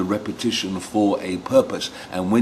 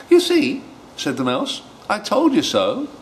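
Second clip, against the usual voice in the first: high, smug mouse; gloating mouse; 11 semitones higher than usual. Acted. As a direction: say it cocky.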